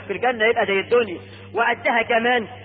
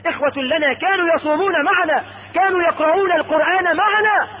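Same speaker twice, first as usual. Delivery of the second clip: high, rising intercession; pleading; 7 semitones higher than usual. Acted. Believers pleading with God for their brothers, earnest. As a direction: plead insistently, pitch climbing.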